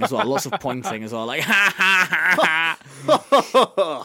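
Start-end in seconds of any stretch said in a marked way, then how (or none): 1.5-4.1 loud, high bellowed "ha ha ha"; mock big laugh. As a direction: bellow out slow, exaggerated laughs. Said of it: "bellow out a big laugh".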